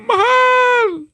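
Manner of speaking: high, loud grieving cry of the king; anguished cry. Acted. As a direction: sigaw ng haring nagdadalamhati. King cries out for dead wife. (malakas na sigaw ng pangungulila)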